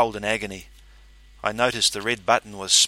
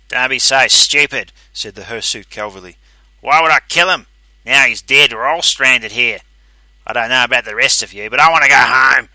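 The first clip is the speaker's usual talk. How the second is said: angry; 3 semitones higher, 12 dB louder than usual. A narrator's reading. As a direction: argue back indignantly. Hirsute Calverley argues back.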